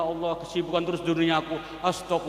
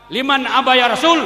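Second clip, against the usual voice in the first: raised, ringing Arabic recitation; loud recitation; 7 semitones higher, 10 dB louder than usual. Acted. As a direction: call out the hadith words. Reciting hadith question aloud. (lantunan hadis lantang)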